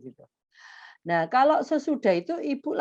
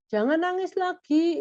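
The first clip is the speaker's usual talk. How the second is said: put-on parent to child; 9 semitones higher than usual. Parent voiced addressing crying child.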